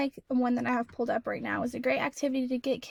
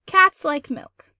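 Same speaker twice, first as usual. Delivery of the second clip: loud plain statement; 3 semitones higher, 9 dB louder, slower than usual. Shown as a demonstration. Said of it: "just need a period because that's not a question".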